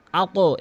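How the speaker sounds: আকো with k released on a short o; upward qalqala. Shown as a demonstration. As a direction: bounce আকো upward off the back of the mouth. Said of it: "এভাবে". (আকো, উপরের দিকে ধাক্কা)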